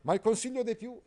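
high, loud pompous knight; Agilulfo pompous. Acted. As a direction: declaim like a stiff courtly knight. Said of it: "disse Agilulfo". (Agilulfo, cavaliere pomposo, acuto e forte)

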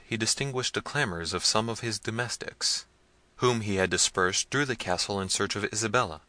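ordinary delivery, nothing marked

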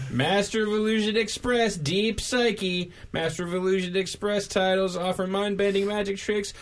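high, showy stage magician patter. Acted. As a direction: announce it like a vaudeville magician. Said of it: "that was a good magician guy voice".

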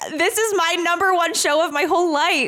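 can barely contain her delight; gushing. Real, not acted. Host tells Camille her favourite show, laughing and gushing.

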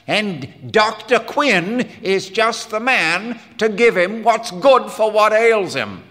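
loud, high-pitched self-important declaration; big, emphatic swagger; blustering boast. Acted. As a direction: proclaim yourself the cure. Quinn boasts he will cure boy.

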